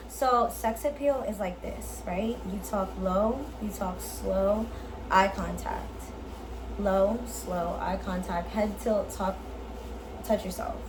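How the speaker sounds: low, slow and seductive; sexy low voice. Shown as a demonstration. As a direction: talk low and slow to draw them in. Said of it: "You see the difference in my fucking voice?".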